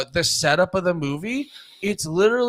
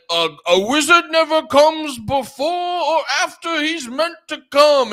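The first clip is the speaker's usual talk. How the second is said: Gandalf; 7 semitones higher, 5 dB louder than usual. Acted. Wizard answering the hobbit, hammed-up Gandalf. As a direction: draw it out like a mock British wizard. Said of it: "And he's like".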